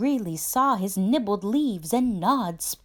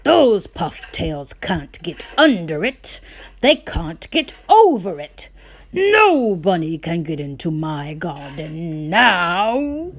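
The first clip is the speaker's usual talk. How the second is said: louder smug boast; gloating farmer; 2 semitones lower, 6 dB louder than usual. Acted. Farmer boasts the flop ears can't get in, loud and smug. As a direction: crow over the new wall.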